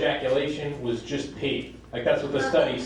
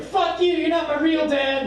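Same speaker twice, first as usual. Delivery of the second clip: put-on high-pitched angry teen yell; acted tantrum at a stepfather; teenage outburst; 14 semitones higher, 3 dB louder than usual. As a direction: hurl it back in a high shout.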